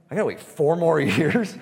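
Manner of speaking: loud, high, laughing disbelief; incredulous. Retold or imitated. Recovering addict recalling his dread of a five-year wait, laughing in loud disbelief. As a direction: balk at the idea with a laugh.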